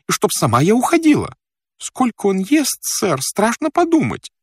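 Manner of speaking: high, plaintive woman servant listing her grievances; fretting housekeeper. Acted. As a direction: complain at length in a high servant's voice. (причитающая служанка, высоким голосом)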